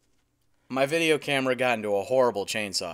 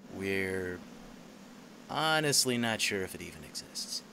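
nature documentary voiceover; 6 dB quieter than usual. Acted. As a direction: narrate like a nature show host, hushed. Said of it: "a voiceover for a nature documentary".

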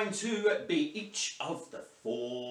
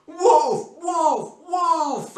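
a big, loud, high Wolf; loud Wolf call; 12 semitones higher, 12 dB louder, much slower than usual. Shown as a demonstration. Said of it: "really, really loudly".

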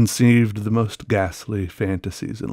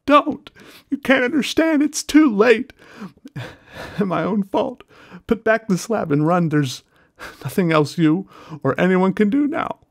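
much higher, louder despairing scream; 12 semitones higher, 4 dB louder than usual. Acted. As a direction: scream it in hopeless terror. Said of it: "changed to a scream of utter despair".

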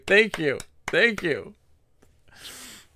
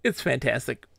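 normal talking voice

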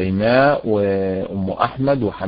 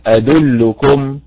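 "أدلكم" said slowly with the stress on the end, as wrong; wrong stress repeated; 3 semitones higher, 3 dB louder, much slower than usual. Shown as a demonstration. Teacher repeats the wrong reading of أدلكم. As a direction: stretch "أدلكم" again, the stress misplaced on the end. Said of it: "هي ليست".

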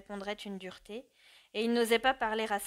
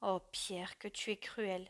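softer, hushed reproach; low murmur; 2 semitones lower, 6 dB quieter than usual. A narrator's reading. Mother murmurs softly to her cruel son. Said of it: "Elle murmura d'une voix basse, tremblante d'émotion".

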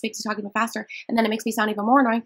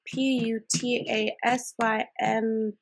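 slow literal spelling; much slower than usual. Acted. Literal caller spells everything slowly.

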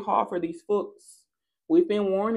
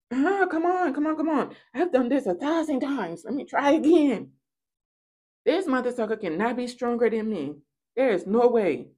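higher, frantic mock practitioner; mimicking frantic practitioner; 5 semitones higher than usual. Rival practitioner desperately forcing a spell. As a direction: urge the spell on in a rush. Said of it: "I said".

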